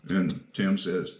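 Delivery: ordinary talk, nothing marked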